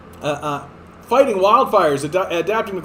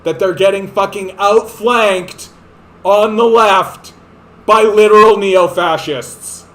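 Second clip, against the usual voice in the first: angry shout; 3 semitones higher, 7 dB louder, slower than usual. Real, not acted. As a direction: yell it out in furious disbelief.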